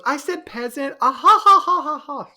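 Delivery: high mock voice breaking into loud laughter; insist, then burst out cackling; laughing girlfriend. Mimicking his girlfriend insisting, then bursting into high laughter. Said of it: "She burst out laughing".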